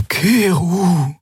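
put-on high, pressing old woman's voice; urgent plea. A narrator's reading. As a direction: call his name with urgency. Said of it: "sagte Xea eindringlich".

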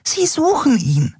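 very high, frantic little voice; alarmed, high-pitched ranting; agitated Nelen. A narrator's reading. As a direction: argue in a high squeak.

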